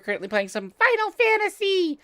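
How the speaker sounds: ordinary talk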